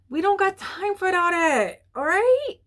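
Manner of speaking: cutting off a friend who wastes your time, sassy and high; sassy dismissal. Pretend. Telling the viewer to drop the friend, sassy and high. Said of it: "I don't know why".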